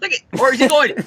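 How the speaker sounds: usual speaking voice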